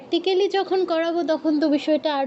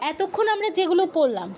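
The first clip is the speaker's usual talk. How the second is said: usual speaking voice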